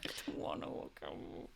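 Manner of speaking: very soft, almost voiceless mumble; tiny mock-reluctant whisper; muttered inner protest. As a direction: mutter it under your breath, trailing away. Acted inner voice, nearly voiceless.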